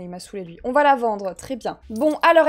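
ordinary delivery

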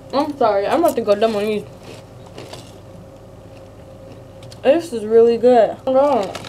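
speaking between bites; talking while chewing chips. Real, not acted. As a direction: mouth full of crunchy food. Seen 3: Chip to mouth and chewing across frames; hand covers mouth near end.

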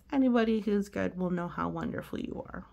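usual speaking voice

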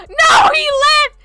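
high, loud mock wail. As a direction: wail at being ditched. Friend leaves, mock wail.

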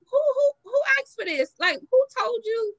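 high, loud, incredulous squeal; exasperated outrage. Retold or imitated. Opening an unwanted picture, high incredulous outrage. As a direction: shriek it like you can't believe what you're seeing.

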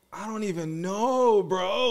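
high, slow, spaced-out reply; mock out-of-it answer to the cop; acting dazed. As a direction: play dumb and dazed to the cop. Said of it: "trying to act so out of it".

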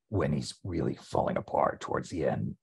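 a hint of old Snake falling apart. Shown as a demonstration. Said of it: "old Snake".

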